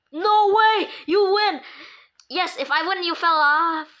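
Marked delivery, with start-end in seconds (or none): 0.1-1.6 loud, high cry of disbelief; excited shout. Real, not acted. Kid reacting to the game with an excited shout. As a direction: blurt it out, thrilled.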